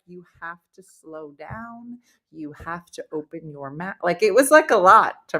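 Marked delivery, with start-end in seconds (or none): 0.1-4.0 slow, soft, low dialect-coach imitation; slow, careful diction. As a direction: spell it out slowly and softly, imitating a coach. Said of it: "work with a dialect coach".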